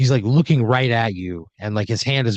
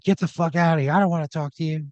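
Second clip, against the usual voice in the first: higher-pitched irritated brush-off; lashing out; 6 semitones higher than usual. Acted. Caricature artist to camera crew, lashing out. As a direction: snap at them to go away, voice pitched up. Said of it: "lashing out at that".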